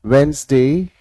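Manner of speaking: "वेडनेसडे" as WENZ-day, lilting up at the end; silent D. Shown as a demonstration. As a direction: model "वेडनेसडे" for a class, no D before the N. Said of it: "इसमें डी का प्रयोग नहीं होगा".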